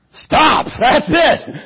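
loud high call to stop; shouting stop; emphatic shout. Acted. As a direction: put your foot down hard. Storyteller acting donkey's decision.

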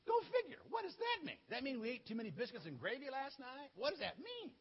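high-pitched, baffled questioning; mock bewilderment. Soldier asks friend what the dream means, bewildered. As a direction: play a puzzled soldier, pitch jumping high.

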